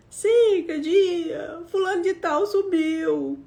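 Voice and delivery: high, tearful call to her sisters; crying plea. Retold or imitated. Telling her sisters through tears, crying. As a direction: sob out the news, voice up high.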